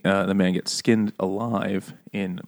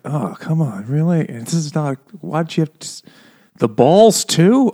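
mock-exasperated, pitched up, loud at the end; exasperated protest; 6 semitones higher, 3 dB louder than usual. Character protesting at what he keeps reading, exasperated. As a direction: plead in disbelief, building to a loud finish. Acting out dismayed reader of letter.

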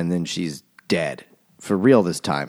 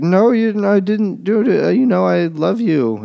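high, louder mock pleading; pleading Verity; 11 semitones higher, 4 dB louder than usual. Verity pleading with her husband, high mock panic. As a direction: squeak out a panicked plea.

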